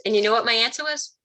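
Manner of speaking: ordinary delivery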